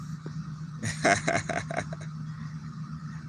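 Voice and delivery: long, soft, rapid chuckle; quiet voiceless run of laughter. Real, not acted. Streamer amused by a chat comment, long soft chuckle.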